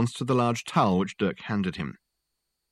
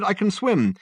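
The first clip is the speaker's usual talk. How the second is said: high-pitched spluttered protest; spluttering; 11 semitones higher, 4 dB louder than usual. A narrator's reading. Swimmer in canal insisting to rescuer, spluttering. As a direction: protest while floundering, pitch well up. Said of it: "spluttered Richard".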